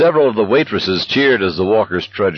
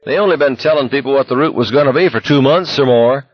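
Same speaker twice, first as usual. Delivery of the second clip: braying; 8 semitones higher, 3 dB louder than usual. A narrator's reading. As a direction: bray out the scorn. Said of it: "Collie Parker brayed".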